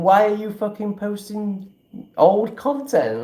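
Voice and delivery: high-pitched, drawn-out mimicry of a whiny critic; mock-outraged question, stretching the last words; mock complaint.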